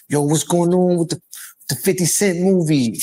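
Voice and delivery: put-on quick New York street accent. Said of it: "I had to use my New York accent".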